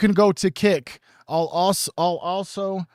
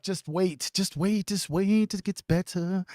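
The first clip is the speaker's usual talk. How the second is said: hushed teasing; 3 semitones higher, 5 dB quieter, slower than usual. Acted. Teasing the new setup.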